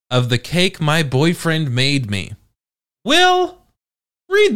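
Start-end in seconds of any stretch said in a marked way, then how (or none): 3.0-4.6 high call to summon Will. Real, not acted. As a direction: sing out the name high. Summoning the text-to-speech voice.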